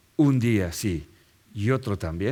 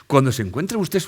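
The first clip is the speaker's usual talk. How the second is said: ordinary talk, nothing marked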